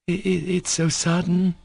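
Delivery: high, hesitant stammer; faltering. A narrator's reading. Katya, taken aback by the message, answers falteringly. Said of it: "faltered Katya".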